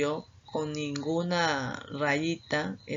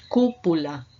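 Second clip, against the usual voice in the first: Cúpula with the first syllable pushed louder; stress on CÚ; 6 dB louder than usual. Shown as a demonstration. Teacher showing the class where Cúpula is stressed, louder. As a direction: hit the cú in Cúpula. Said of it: "que es la sílaba Q".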